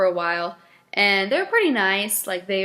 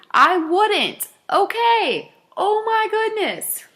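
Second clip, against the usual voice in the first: exasperated rant; 6 semitones higher than usual. Real, not acted. Drive-thru worker fuming at a dog treat accusation, exasperated and high. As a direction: vent that you'd never lie about treats.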